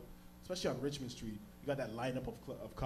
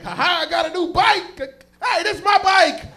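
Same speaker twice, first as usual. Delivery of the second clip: very high, loud, laughing shouts; a kid cackling over a stolen bike; gleeful shout; 17 dB louder than usual. Retold or imitated.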